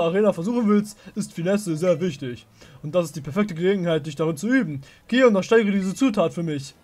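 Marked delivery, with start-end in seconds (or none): none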